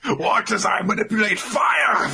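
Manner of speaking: high, hammy wizard shout; over-the-top magician's boast; showboating Chrome. Acted. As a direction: announce the trick with mock grandeur. Chrome showing off fire trick.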